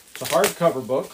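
ordinary talk, nothing marked

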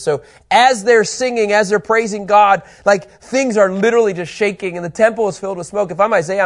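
loud, high-pitched, emphatic preaching; impassioned preaching. Acted. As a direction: preach it with rising force, punching key words. Preaches with raised force.

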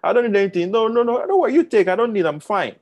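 acted proud person frantically declining help; defensive refusal. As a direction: wave it away in a rising fluster. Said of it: "So they say".

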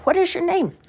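high, questioning village women; coaxing women. A narrator's reading. Village women ask the child her name, high and coaxing. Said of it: "they said".

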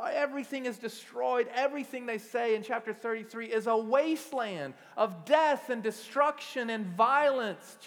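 loud, high, impassioned sermon voice; full-force preaching. Acted. Pastor rousing the congregation, loud fervent preaching. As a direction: drive it home to the church. Preaching voicing the people's lament.

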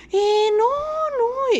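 high, evasive "no, no"; evasive dodge. Retold or imitated. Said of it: "así como evadiendo".

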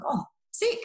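high, squeaky mock excitement; mock delight. Mocking the news that lockdown is lifting, high mock cheer. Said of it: "And you're like".

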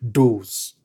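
those said low and firm, falling; stress on those. Shown as a demonstration. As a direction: lean on the word those. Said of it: "emphasis on the word".

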